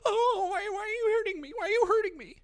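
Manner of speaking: high, whimpering mock cry of hurt innocence; mock victim whine. Mocking critics who play victim, high fake sob. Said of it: "they go".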